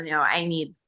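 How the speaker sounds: usual speaking voice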